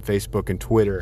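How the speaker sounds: ordinary talk, nothing marked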